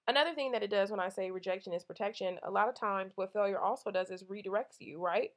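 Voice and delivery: usual speaking voice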